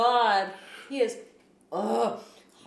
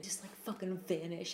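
ordinary talk, nothing marked

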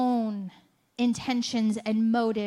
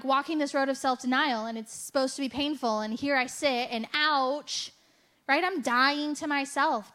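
ordinary delivery

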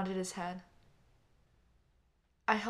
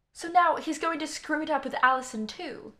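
female heckler; 6 semitones higher, 7 dB louder than usual. Acted. Woman heckling in the bar.

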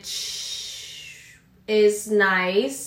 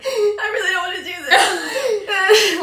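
mock crying; 10 semitones higher, 4 dB louder, slower than usual. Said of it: "I was, like, crying".

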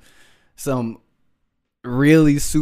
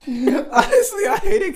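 laughing; slower than usual. Real, not acted. Laughing mid-story.

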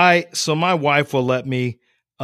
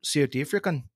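South African accent; 7 dB quieter than usual. Shown as a demonstration. Podcaster trying out the tricky accent, South African accent. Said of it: "African is hard".

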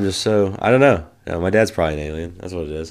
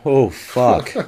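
laughing dismay; 6 semitones higher, 3 dB louder than usual. Retold or imitated. Retold reaction to hot salsa.

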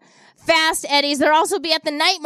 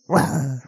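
much lower, growled lion roar; better lion roar; 11 semitones lower than usual. Pretend. Host retrying the lion for listeners, deep growled roar. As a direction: drop way down and growl it out. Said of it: "that was a better one".

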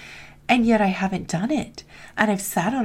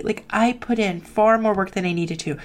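normal talking voice

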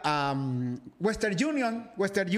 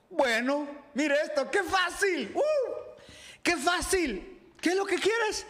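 high, bright-hearted teasing tone; Jesus amused by the simple request; playful Jesus; 8 semitones higher than usual. A narrator's reading. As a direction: lift the voice, amused and easy. Jesus voiced lightly, playful.

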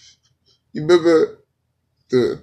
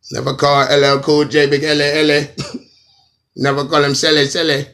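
raised, loud mock word-doubling; 4 semitones higher, 6 dB louder than usual. Comic mimicking repetitive singer, loud mock dancehall chant. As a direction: belt the doubled names as a parodied hook. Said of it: "saying the same thing twice".

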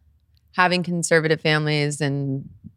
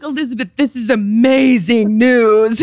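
higher, louder, thrilled announcement; retold delight; 8 semitones higher, 6 dB louder than usual. Stepdad congratulating his pregnant daughter, raised loud delight. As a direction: imitate an overjoyed parent.

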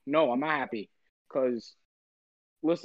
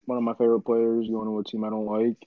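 lower, sleepy, dragged-out tone; groggy morning voice; 5 semitones lower than usual. Real, not acted. Host just woken up, talking trade news in a groggy low voice. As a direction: sounds like he just woke up and is down about it. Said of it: "is this your morning voice or something like that".

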